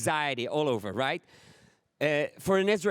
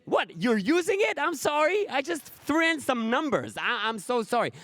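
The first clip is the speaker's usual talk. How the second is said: raised, sheepish apology; hand-wringing inventor who's sorry; apologetic Fahrenheit; 6 semitones higher than usual. Retold or imitated. Comedian voicing Fahrenheit apologizing sheepishly. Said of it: "even Fahrenheit himself, he said".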